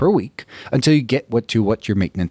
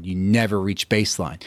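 usual speaking voice

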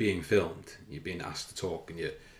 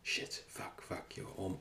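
whispered, mock inner voice cursing at himself; hushed self-talk on hearing yourself back; imagined self-critical whisper; 7 dB quieter, slower than usual. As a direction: whisper it like your own harsh inner critic. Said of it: "it might just be that".

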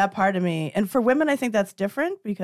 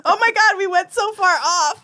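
laughing; 11 semitones higher, 5 dB louder than usual. Real, not acted. Laughing, high.

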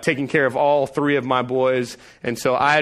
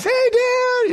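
put-on much higher child's greeting; sweet kid voice. Sons greeting their father, sweet high child voice. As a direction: innocent little boys. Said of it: "all my boys".

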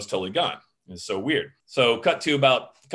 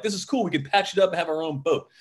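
put-on higher-pitched eager kid quote; excited kids; 5 semitones higher than usual. Kids scheming over a found boat, mildly excited. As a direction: mimic kids thrilled by their plan.

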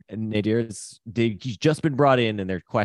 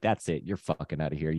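quiet dismissive threat; 6 semitones lower, 5 dB quieter than usual. Retold or imitated. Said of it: "that look he gives is just like".

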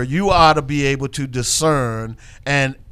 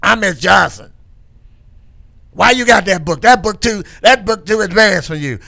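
raised, louder mock-teacher voice; Miss Johnson; 5 semitones higher, 4 dB louder than usual. Imitating a sixth grade teacher challenging a pupil's book, scolding. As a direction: imitate a scolding old schoolteacher. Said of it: "She talked like this".

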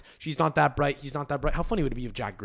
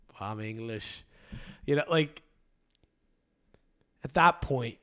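ordinary delivery

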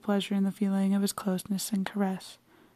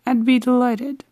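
coldly; 4 semitones higher, 9 dB louder than usual. A narrator's reading. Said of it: "he said coldly".

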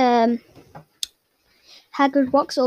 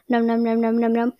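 rhythmic, even "nom nom" noises; imitated munching. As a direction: chomp through the words like cartoon eating. Imitates Dudley casually eating cake.

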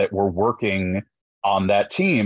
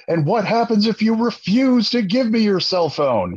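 R. Lee Ermey; 11 semitones higher than usual. Retold or imitated. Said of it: "I go full R. Lee Ermey".

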